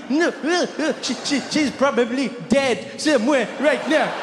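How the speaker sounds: put-on high, stammering little boy voice; a ten-year-old reassuring his teacher, high and stammering; nervous kid voice. Ten-year-old boy to his teacher, high nervous stammer.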